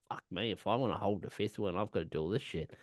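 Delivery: low, quick grumble under the breath; soft, fast reenacted inner thought; muttered exasperation. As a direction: grumble it quietly to yourself. Acting out own exasperated inner thought.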